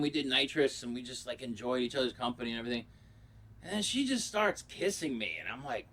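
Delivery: usual speaking voice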